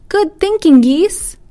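put-on very high, loud child's cheer. A narrator's reading. Child praises geese from below, cheering. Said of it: "Another cheered".

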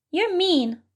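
high-pitched little mouse; cross field mouse. Acted. Mouse accusing head-bopping bunny, cross high voice.

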